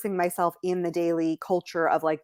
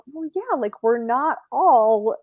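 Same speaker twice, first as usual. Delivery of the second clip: high start, then firmer and louder; defiant reply; 5 semitones higher, 3 dB louder than usual. Retold or imitated. An American answering surprised locals, a touch defiant. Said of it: "And I'm like".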